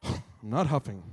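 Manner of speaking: low, huffy mock denial; grumpy denial. Husband answering his wife's complaint, grumpy denial. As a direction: deny it grumpily, pitch dropped. Acted couple's quarrel over huffing.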